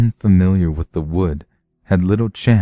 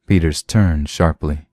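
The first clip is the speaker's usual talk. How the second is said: usual speaking voice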